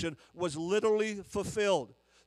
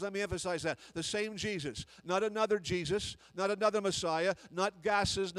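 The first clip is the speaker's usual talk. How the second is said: usual speaking voice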